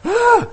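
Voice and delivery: high, loud startled yelp; mock-terrified scream; alarmed cry. Sports parent reacting to a yelling dad, alarmed cry.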